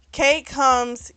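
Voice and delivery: ordinary delivery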